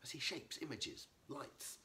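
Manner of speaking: a touch of indignation, rising on these; mild anger. Acted. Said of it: "she said, angrily".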